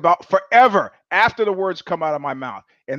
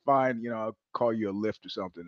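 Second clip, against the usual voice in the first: softer, lower, giving up; quiet, deflated brush-off; 7 semitones lower, 11 dB quieter than usual. Retold or imitated. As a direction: let it drop quietly, like he's tapping out. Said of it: "He goes".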